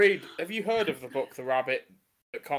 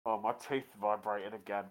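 ordinary delivery

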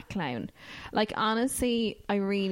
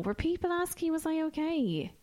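mock disbelief; 9 semitones higher than usual. Mock disbelief at callers' concern.

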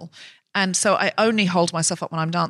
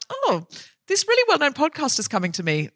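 higher-pitched mock therapist musing; hammed-up delight, pitched up high; imagined therapist; 8 semitones higher than usual. Therapist imagined eyeing her podcaster client, mock delighted.

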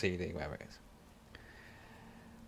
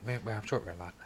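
ordinary delivery

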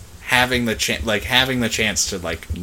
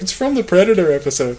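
higher-pitched eager fan reply; imagined fan; 8 semitones higher, 3 dB louder than usual. Retold or imitated. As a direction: lightly mimic a keen listener. Voices imagined fans replying.

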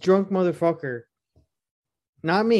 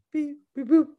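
high beep boop noises; robot beeps; 9 semitones higher than usual. Retold or imitated. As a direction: mimic a computer beeping. Mimicking algebra in head.

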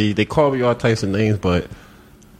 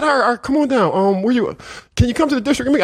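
officer quoted in a much higher voice; eager officer; much faster than usual. Retold or imitated. Officer inviting the caller down, in a high eager imitation. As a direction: play him high and keen to help. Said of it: "he said".

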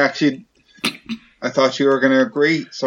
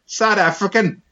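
South African accent; 9 semitones higher, slower than usual. Shown as a demonstration. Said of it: "what accent would you like me to read".

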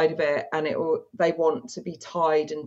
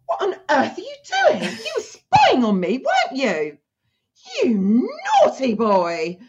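much higher, louder mock scolding, slowing at the end; mock outraged; 6 semitones higher, 3 dB louder than usual. Catching the client spying, high mock outrage. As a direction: scold him like a shocked, teasing stepmom. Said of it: "I'll be like".

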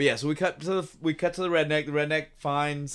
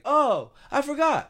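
put-on much higher, slow and louder airy girl voice; ditzy girl; 12 semitones higher, 4 dB louder, slower than usual. [name] remembering to call her dad, mock ditzy. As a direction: play it like a scatterbrained teen.

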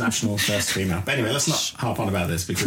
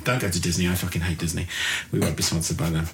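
normal talking voice

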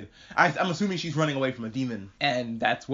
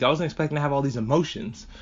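ordinary delivery

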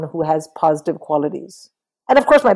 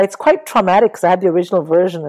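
usual speaking voice

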